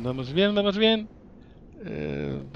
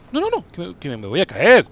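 scared; 3 semitones higher, 8 dB louder than usual. Real, not acted. About to fall.

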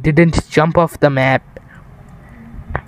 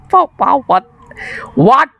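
surprised what; 8 semitones higher than usual. Real, not acted. Shocked by teammate's words.

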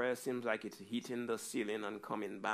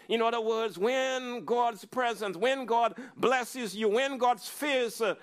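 higher, louder, rousing sermon climax; preaching at full force; 12 semitones higher, 9 dB louder than usual. Acted. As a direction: lift the congregation with rising fervor. Preaching on the blessing.

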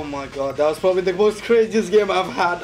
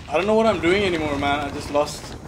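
usual speaking voice

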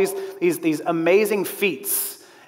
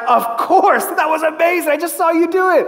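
laughing, higher-pitched amazed reply; amazed promoter; 8 semitones higher, 3 dB louder than usual. Retold or imitated. Promoter answers the tightrope walker, amazed and laughing. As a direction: gush like he can't believe his eyes.

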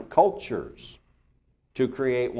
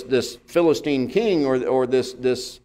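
normal talking voice

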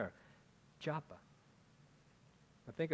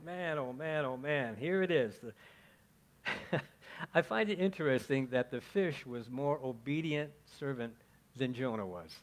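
ordinary talk

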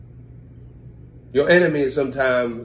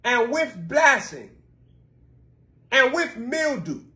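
higher, slow preaching cadence; 9 semitones higher than usual. Acted. Pastor preaching the curses to the congregation, higher and slow. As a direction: call out the curses from the pulpit.

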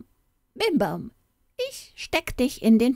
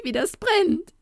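even higher goblin wail on brennt; wild cry of pain; 13 semitones higher than usual. Acted. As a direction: shriek brennt at the top of the voice. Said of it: "schrie er wild".

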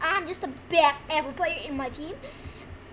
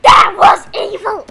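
very loud outraged gamer scream; 3 semitones higher, 15 dB louder than usual. Real, not acted. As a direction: scream it at the game. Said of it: "Okay, I'm screaming".

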